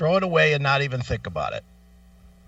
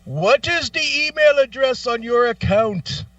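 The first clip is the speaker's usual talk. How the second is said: mock phone agent; 8 semitones higher, 3 dB louder than usual. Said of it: "So then he goes".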